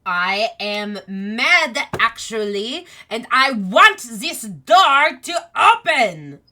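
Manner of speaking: loud, slow, hammed-up tantrum; Chess. Chess demands the locked door open, loud acted anger. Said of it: "like, loud".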